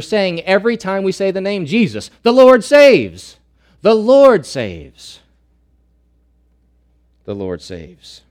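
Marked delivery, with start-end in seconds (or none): 2.2-5.2 loud, high, slow proclamation; proclaimed. Real, not acted. Preacher proclaims the name's meaning. 7.2-8.2 soft, low echo; hushed after the shout; quiet repeat. Real, not acted. Repeats the phrase after the proclamation.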